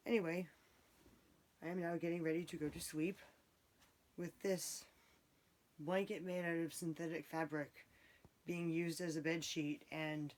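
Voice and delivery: soft, hushed late-night talk; hushed bedtime voice. Real, not acted. Bedtime talk, family asleep.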